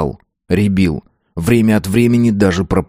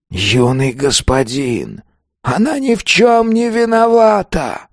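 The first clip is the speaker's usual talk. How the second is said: high, slow begging cry; desperate pleading spirit; 9 semitones higher, slower than usual. A narrator's reading. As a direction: plead for her life. Ghost defends the girl.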